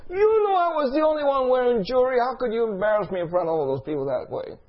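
high wail of outrage, coming down in pitch; imitated angry yelling. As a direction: start screeching as the livid woman, then let it sink. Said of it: "She's yelling at me".

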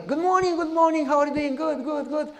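high, bright sing-song hello; man's cheery hello to his neighbor; cheery greeting. Retold or imitated. Man greets millionaire neighbor cheerfully.

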